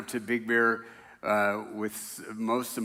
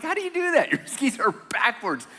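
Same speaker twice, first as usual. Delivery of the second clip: high-pitched disbelief at backwards skis; baffled exclamation; 11 semitones higher than usual. Retold or imitated. Grandpa baffled by grandson's backwards skis, high-pitched disbelief. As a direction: squeak out your confusion. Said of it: "I'm like".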